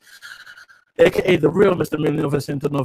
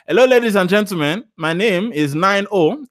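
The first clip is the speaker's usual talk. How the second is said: put-on higher-pitched Nigerian accent; 4 semitones higher, 4 dB louder than usual. Streamer restarting his intro for the viewers, Nigerian accent. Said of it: "I'll do it in my Nigerian accent".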